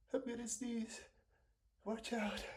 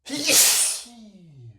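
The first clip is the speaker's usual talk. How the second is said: loud sneeze; 19 dB louder than usual. Real, not acted. Sneezing on camera.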